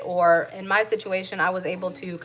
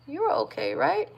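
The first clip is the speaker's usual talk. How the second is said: higher, gentle reassuring coo; 9 semitones higher than usual. Real, not acted. Soothing her newborn.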